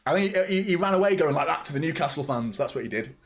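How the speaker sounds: normal talking voice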